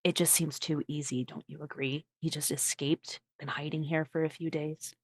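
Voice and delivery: very soft, secretive character voice; hushed persuasion. Acted. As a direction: argue your suspicion almost in a whisper. Character quietly argues her suspicion.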